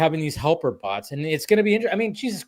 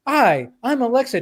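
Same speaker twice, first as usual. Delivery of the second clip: higher-pitched, evenly polite assistant greeting; standard voice assistant; 10 semitones higher, 4 dB louder than usual. Retold or imitated. Podcaster mimicking the old Alexa, standard assistant voice. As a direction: imitate a normal smart speaker introducing itself. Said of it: "the normal Alexa that just talked like Alexa".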